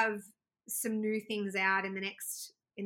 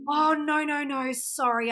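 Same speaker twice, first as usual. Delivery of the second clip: retold flustered no, higher and louder; embarrassed no no no to the French gentleman; flustered refusal; 6 semitones higher, 6 dB louder, slower than usual. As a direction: brush him off in a hurry, louder and higher. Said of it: "I was like".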